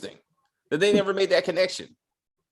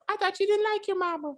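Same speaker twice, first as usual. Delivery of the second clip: put-on high, babyish child voice; mock the sweet kid delivery; fake kid voice; 14 semitones higher, 4 dB quieter than usual. Imitating the child actress to a cohost. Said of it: "that child voice".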